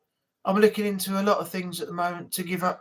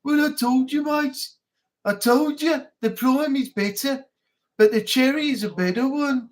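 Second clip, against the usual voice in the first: put-on higher, perked-up Birmingham accent; cheerful Birmingham; 3 semitones higher than usual. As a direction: add joy to the Brummie pitch. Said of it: "put some joy into it".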